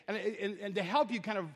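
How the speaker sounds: ordinary talk, nothing marked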